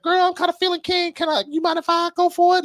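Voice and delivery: high, put-on girly voice; hammed-up falsetto asking to go for it; mock girlfriend voice. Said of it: "if she was like".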